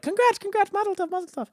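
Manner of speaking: high, gushing congratulations; gushing well-wishers. Retold or imitated. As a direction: gush your congratulations way up high. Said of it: "'cause they were all like".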